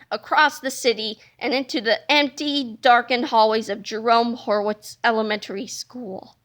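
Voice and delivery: ordinary delivery, nothing marked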